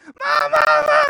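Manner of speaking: very high loud calls; screaming for mom. Retold or imitated. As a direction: wail for mom like a hurt child. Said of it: "she's like".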